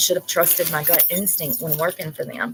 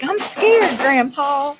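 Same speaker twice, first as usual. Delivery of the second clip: put-on 1 little boy voice; 6 semitones higher, 4 dB louder, much slower than usual. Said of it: "the little boy's like".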